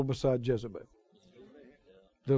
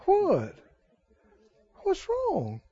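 coaxing wife; 7 semitones higher, slower than usual. Acted. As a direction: plead sweetly with a sulking man. Jezebel coaxing sulking husband.

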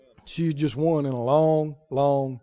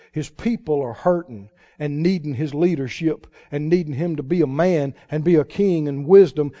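usual speaking voice

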